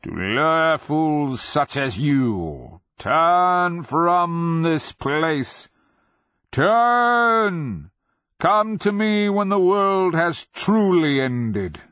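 slow, rising to a roaring dragon shout; booming dragon voice. A narrator's reading. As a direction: build from sneer to thundering command. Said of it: "dragon's booming echo".

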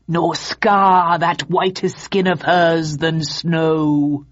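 very slow, crackling machine voice; a crude talking dummy reciting; crackly mannequin voice. Acted. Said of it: "crude voice box crackled".

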